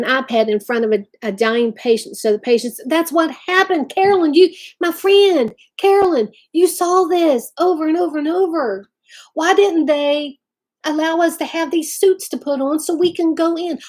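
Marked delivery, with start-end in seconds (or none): 2.9-13.8 impassioned outburst. Real, not acted. Impassioned plea to friend Carolyn.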